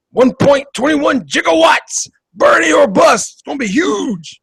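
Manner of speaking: high, hollering old-politician impression; Bernie Sanders. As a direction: declaim it like an excitable rally speaker. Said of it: "as Bernie would say".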